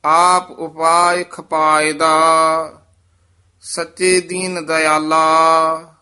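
measured, sustained verse recital; scripture recitation. Acted. Teacher reciting scripture for students, measured recitation. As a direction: recite sacred verse with drawn-out line endings.

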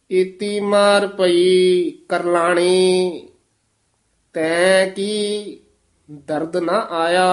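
slow scripture recitation, long held endings; Gurbani ਪਾਠ. Acted. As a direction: recite each phrase slowly, stretching the vowels. (ਹੌਲੀ, ਲਮਕਾ ਕੇ ਗੁਰਬਾਣੀ ਪਾਠ)

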